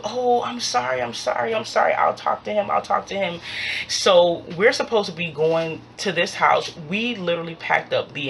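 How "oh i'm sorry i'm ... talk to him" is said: higher, repeated hurried apology; retold apology. David apologizing about the random dude, high-pitched placating. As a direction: placate with a string of sorries. Voices apologetic friend on phone.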